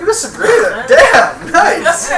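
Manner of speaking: high, loud laughing delight; teacher laughing as a student gets it right, high and loud. Real, not acted. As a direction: laugh it out, pleased. Laughs while agreeing with student.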